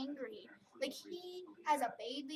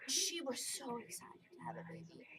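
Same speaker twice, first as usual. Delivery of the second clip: whispered, choked-up aside; tearful whisper; 6 dB quieter than usual. Real, not acted. Talking about April's baby dying, sad tearful whisper. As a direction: hush it as if holding back tears. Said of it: "I'm tearing up right now".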